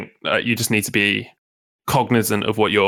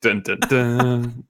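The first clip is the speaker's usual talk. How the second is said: dramatic sting; much slower than usual. Pretend. Mock dramatic tease sting.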